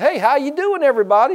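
high, loud, overbright hello; fake cheerful greeting. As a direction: act thrilled to see everyone. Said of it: "why do they act like an idiot".

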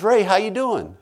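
high-pitched, carefree hello; lilting, casual dad voice; father's breezy greeting. Retold or imitated. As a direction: greet lightly, as if nothing happened.

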